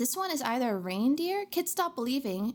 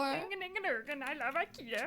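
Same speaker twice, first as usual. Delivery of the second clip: mock Swedish chef; 7 dB quieter than usual. Streamer doing the Swedish chef for chat. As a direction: ham up the Muppets chef. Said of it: "that's what I was trying to go for".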